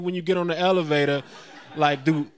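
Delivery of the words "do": ordinary delivery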